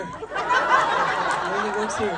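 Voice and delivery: slowed-down mock emphasis; slow low repeat. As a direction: stretch it out slowly, dropping low.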